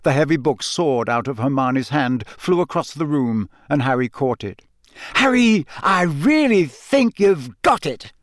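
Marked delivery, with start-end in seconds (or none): 5.2-8.1 high, drawn-out, delighted praise; delighted Hermione. A narrator's reading. Hermione praising Harry's spell, delighted. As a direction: let the delight ring out, slow and high. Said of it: "said Hermione delightedly".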